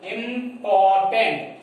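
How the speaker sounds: very slow "Important"; slow drawn-out word. Shown as a demonstration. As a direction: stretch "Important" out slowly to show its syllables. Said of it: "three syllables".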